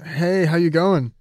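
close-miked, boomy greeting; too close to mic. Real, not acted. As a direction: lips right on the mic. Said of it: "You sound very close".

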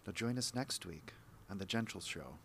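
smooth show-host sign-off; TV host outro. Acted. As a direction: read it like a gentle TV host. Host outro, retaken several times.

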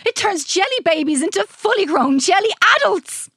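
high, loud boast; excitable mad scientist. A narrator's reading. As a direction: burst out with the next wonder.

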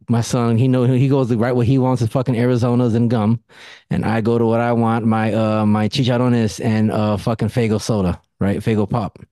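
normal talking voice

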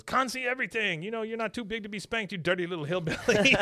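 put-on high-pitched accented yelling; mock yelling. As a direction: scold the boy from the ladder in a high voice. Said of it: "he's yelling at Bobby".

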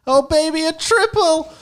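very high, loud excited shout; excited squeal. Real, not acted. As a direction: squeal it like a thrilled fan. Excited outburst over maths joke.

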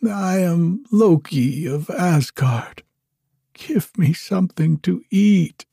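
high, weak piping voice; a starved prisoner begging, high and thin; piping feebly. A narrator's reading. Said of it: "Loki piped feebly".

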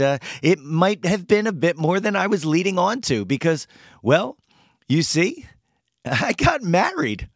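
ordinary talk

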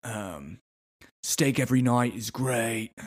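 understated mock British accent, nearly Australian; British gone Australian. Bear Grylls dismissing concern, accent drifting Australian. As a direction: let the British accent slide toward Australian. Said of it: "I think I'm almost more Australian here".

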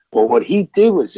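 ordinary delivery

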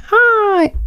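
high, drawn-out "hi"; mock cheery greeting. Greeting friends at the door, high mock-cheery hello. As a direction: sing out a long high hello.

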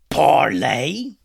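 a pirate captain's angry shout; yelled. Acted. Pirate captain yelling at the boys, angry. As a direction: spit the word out loud. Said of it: "he yelled".

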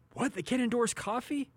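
high, quick mock disbelief at the coffee rule; incredulous. Real, not acted. Radio host scoffing at BYU banning coffee endorsements, incredulous and high. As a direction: scoff at the rule as if it were absurd. Mocking BYU honor code rules.

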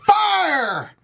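high, drawn-out shout of "Fire!"; misread exclamation. Acted. Host misreading a listener comment, shouted "Fire!". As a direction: yell "Fire!" as if raising the alarm, long and high. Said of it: "Inflection's really important".

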